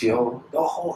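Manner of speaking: ordinary talk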